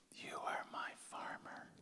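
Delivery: slow, hushed whisper from above; whispered voice of God. Retold or imitated. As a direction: let it drift in as a soft whisper. God's voice answering him.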